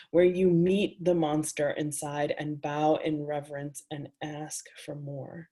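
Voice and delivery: measured, intimate poetry reading; reading her own words to a live audience; reading aloud. A narrator's reading. Author reading her erotic prose to an audience, intimate and measured.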